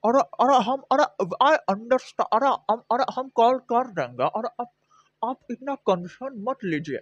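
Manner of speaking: high, fast mumbled Hindi cop impression; gutka-chewing cop. Cop providing levity, high mock mumble. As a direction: rattle it like your mouth is full of gutka. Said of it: "he's like".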